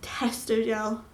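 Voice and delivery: slow, dazed disbelief; stunned repeat. Real, not acted. Vlogger stunned by news, slow dazed repeat. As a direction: let the words sink in slowly. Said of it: "absolute shock".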